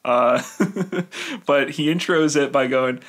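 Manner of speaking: ordinary talk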